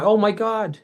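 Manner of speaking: startled cry; mild mock alarm; panicked exclamation. Everyone panics and runs.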